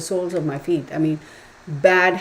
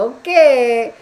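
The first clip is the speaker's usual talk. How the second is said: higher, louder put-on little okay; mock meek okay; 6 semitones higher, 9 dB louder than usual. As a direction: play the caught child giving in. Meek reply after being scolded.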